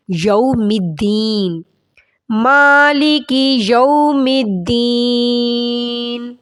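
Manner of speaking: slow, drawn-out tajweed chant; measured Quranic recitation. Shown as a demonstration. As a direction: recite it melodically, stretching the vowels.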